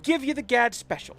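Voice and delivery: high, hammed-up New York gangster menace; put-on gangster accent. Gad threatening Powell with a private room, gangster accent. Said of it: "like a gangster".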